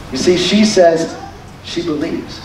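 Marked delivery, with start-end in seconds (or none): none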